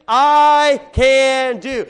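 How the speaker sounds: "I can do" loud and hugely drawn out; loud group recitation. Real, not acted. Preacher leads the congregation in the verse, loud drawn-out recitation. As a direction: belt out each word slowly for all to join.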